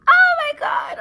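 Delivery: high, loud cry of pure joy; screaming with excitement; excited scream. Real, not acted. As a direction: shriek it with delight. Sees real versus fake.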